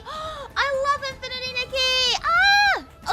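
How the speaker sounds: gasp, then a loud high squeal; excited squeal. Real, not acted. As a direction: burst out in fangirl delight. Fan hears favourite game mentioned.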